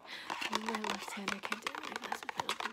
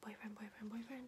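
rapid whispered word repetition; quick aggressive whispered triggers; fast whispering asmr; 4 dB quieter than usual. Acted.

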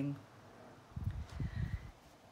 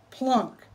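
loud plunk; 5 semitones higher, 5 dB louder than usual. Acted. Sound of fruit striking.

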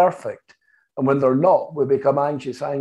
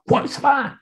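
high, sharp defiant challenge; 11 semitones higher than usual. Retold or imitated. Angry friend challenges the therapist, defiant and high. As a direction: throw the question back defiantly. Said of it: "he defiantly looked at me".

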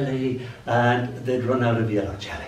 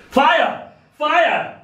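high, loud cry of alarm; panicked shouting; 14 semitones higher, 4 dB louder than usual. Acted. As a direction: yell it as the house burns. Poem character shouting alarm.

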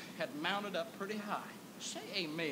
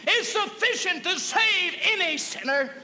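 loud, high, drawn-out preacher shout; preaching at full force; 12 semitones higher, 7 dB louder, slower than usual. Real, not acted. Preacher shouting joy over grace to the congregation.

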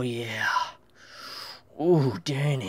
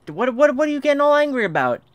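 higher, louder, fast teasing scold; mock scolding; 9 semitones higher, 5 dB louder, faster than usual. Real, not acted. Player teasing the angry dog, fast mock scold. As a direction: tease the dog for getting worked up.

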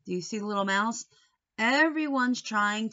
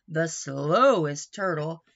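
usual speaking voice

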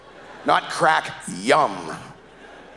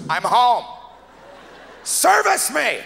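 barge in and demand it; demanding drunk wife; 9 semitones higher, slower than usual. Retold or imitated. Drunk woman comes home.

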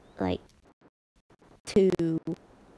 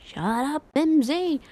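mock yell at Mimsy, pitched up; imitating Nathan; 6 semitones higher, 4 dB louder than usual. Streamer recalls a classmate yelling at Mimsy, mock yell. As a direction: shout it like a bratty kid. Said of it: "It's like".

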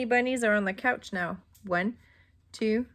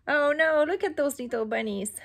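ordinary delivery, nothing marked

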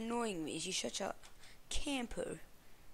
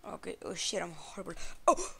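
usual speaking voice

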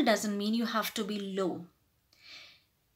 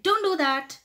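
sharp, higher-pitched rebuke; disgusted order; 7 semitones higher, 4 dB louder than usual. Shown as a demonstration. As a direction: order them to stop, showing irritation. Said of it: "your disgust will come out".

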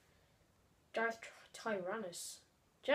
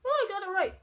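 surprised; 10 semitones higher, 6 dB louder, faster than usual. Real, not acted. Surprised correct answer.

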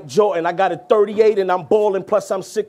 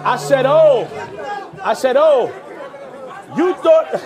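emphatic restart; 4 semitones higher than usual. Acted. Rapper repeating a bar to the crowd, emphatic restart. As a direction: punch it out over the crowd.

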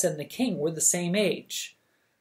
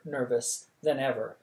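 plain reading voice

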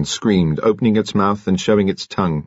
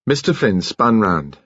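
plain reading voice

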